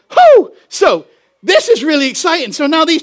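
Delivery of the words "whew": loud, high whoop; excited whoop. Real, not acted. Preacher thrilled by coffee shop church, loud whoop. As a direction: let out a sudden high whoop.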